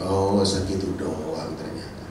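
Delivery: soft, low, slow disdain; calm contempt. Acted. Moses answering the crowd, soft, low and disdainful. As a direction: dismiss them coolly. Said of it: "Musa bilang".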